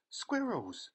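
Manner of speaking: high, thrilled shout of discovery; bright squeal of surprise; excited shout. A narrator's reading. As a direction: blurt it out with delight. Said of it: "shouted Veruca Salt".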